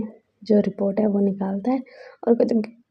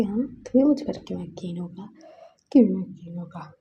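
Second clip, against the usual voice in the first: hushed, tearful whisper; crying whisper; 3 semitones lower, 4 dB quieter than usual. Acted. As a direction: whisper it through tears. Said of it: "वो रो रहा था कि". (रोते हुए फुसफुसाहट)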